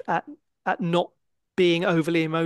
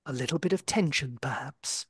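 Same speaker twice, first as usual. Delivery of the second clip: soft, low, dry understated aside; hushed understatement; 4 semitones lower, 7 dB quieter than usual. Retold or imitated. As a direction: murmur it like a whispering snooker commentator. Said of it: "he just said".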